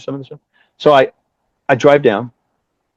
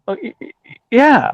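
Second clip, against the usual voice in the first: taken-aback stammer; 10 semitones higher than usual. Retold or imitated. Thrown by the caller's question.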